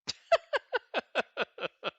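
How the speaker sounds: a real burst of high laughter; loud high laugh. Real, not acted. Teacher laughing after the failed British accent. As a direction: crack up at how bad it sounded.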